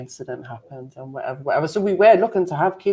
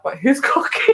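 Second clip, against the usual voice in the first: laughing suspicious question; 9 semitones higher than usual. Retold or imitated. Asking before Sunday dinner.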